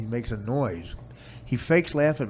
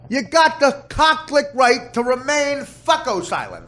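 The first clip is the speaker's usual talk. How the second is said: higher mock Miranda warning; cop reading rights; 10 dB louder, slower than usual. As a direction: read the rights like a foul-mouthed cop. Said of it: "They talk like cops".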